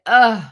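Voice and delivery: loud, fed-up ugh; disgusted groan. Retold or imitated. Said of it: "makes me like".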